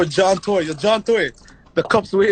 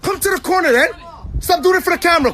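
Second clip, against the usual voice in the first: taunting shout; 11 semitones higher, faster than usual. Real, not acted. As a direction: call him out to fight. Taunting a rival rapper.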